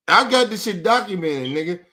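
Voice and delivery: ordinary delivery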